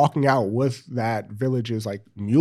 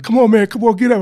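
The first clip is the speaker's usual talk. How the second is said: high, loud urging; high urgent shout; 10 semitones higher, 9 dB louder, faster than usual. Retold or imitated. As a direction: urge him up, high and loud. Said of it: "they're like".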